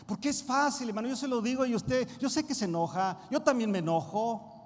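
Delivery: loud, rising sermon cry; preaching at full force. Acted. Pastor urging the church to commit, loud preaching.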